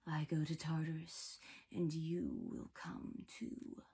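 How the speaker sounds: slow, soft, whispered spider-monster threat; Arachne's menacing whisper. A narrator's reading. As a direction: whisper doom from the pit, drawing it out. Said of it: "if she actually heard Arachne's voice".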